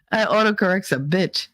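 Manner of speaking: ordinary delivery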